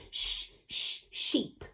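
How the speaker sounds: two hushed "Shh" onsets, then "sheep"; sounding out sh. Shown as a demonstration. Teacher presenting the toy, "Shh, shh, sheep" sounded out. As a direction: separate "Shh" twice before whole "sheep". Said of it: "begins with a sh as well".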